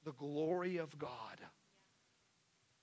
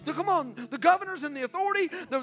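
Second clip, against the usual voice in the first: much higher, much louder fiery preaching; full-force preaching; 8 semitones higher, 15 dB louder than usual. Real, not acted. Pastor preaching full force to the congregation. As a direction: preach it at the top of your voice.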